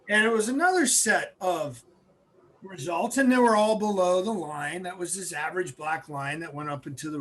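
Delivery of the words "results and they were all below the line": ordinary talk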